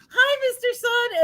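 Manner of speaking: high, sing-song puppet greeting; happy moon voice. Pretend. Demonstrating eclipse with food, moon greets the sun in a high cheery voice. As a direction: voice the cheerful little moon like a toy.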